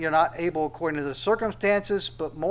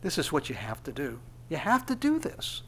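soft, tender coaxing; tender, compassionate; 6 dB quieter than usual. Acted. Jesus bending down to instruct his children, tenderly. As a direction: explain it gently, as to little children. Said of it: "this tender, compassionate".

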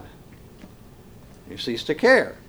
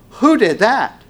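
ringing question; 9 semitones higher, 7 dB louder than usual. Acted. Rhetorical preaching question.

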